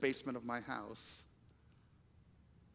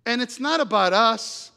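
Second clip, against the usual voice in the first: loud, raised, driving sermon voice; impassioned preaching; 7 semitones higher, 11 dB louder than usual. Real, not acted. Preacher challenges the church to love people, impassioned. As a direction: press each rhetorical question hard on the congregation.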